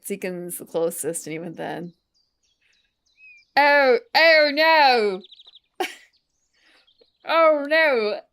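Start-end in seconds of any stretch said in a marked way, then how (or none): 3.6-5.2 long drawn-out Aussie "oh no", high and loud; Australian accent attempt. Streamer attempting an Australian "oh no", slow and hammed-up Aussie. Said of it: "That's not quite it either". 7.3-8.2 high, loud Aussie "oh no"; Australian accent attempt. Streamer retrying an Australian "oh no" that misses, hammed-up Aussie. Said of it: "That's not quite it either".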